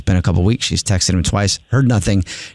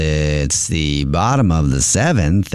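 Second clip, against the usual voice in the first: slow, low ballpark announcer call; baseball announcer voice; 4 semitones lower, much slower than usual. Acted. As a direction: announce it like the game's on the radio. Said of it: "the reason I'm speaking in the baseball announcer voice".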